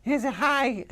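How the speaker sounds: squeaky high goat voice try-out. Shown as a demonstration. Actor testing a high goat voice in the studio, squeaky high. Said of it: "we played around with some higher voices".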